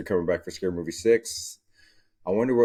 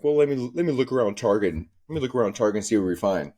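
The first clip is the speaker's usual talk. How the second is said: higher and fast, breezy; quick mock shopper voice; 4 semitones higher, faster than usual. Woman with her coffee wandering Target, fast mock voice. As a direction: rattle it off like a browsing shopper. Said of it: "you're like".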